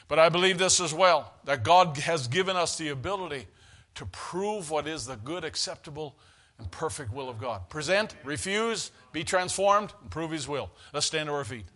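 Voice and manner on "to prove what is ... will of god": soft recitation. Real, not acted. Preacher reciting what is the will of God, soft recitation. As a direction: let each phrase drop softly, with pauses.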